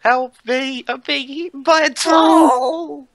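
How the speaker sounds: high, drawn-out hammy wail; mock cry for help. Joker pretends to be eaten by a troll, wailing in mock terror. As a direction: wail slowly in a high, put-on panic.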